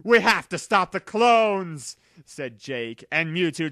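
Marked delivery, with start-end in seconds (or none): 0.0-2.0 loud, urgent call to action; rally the others, fired up and loud; angry shout. Acted. Jake, angry at the clones, shouts to his friends.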